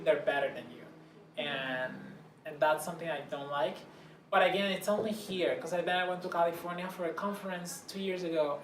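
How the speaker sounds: usual speaking voice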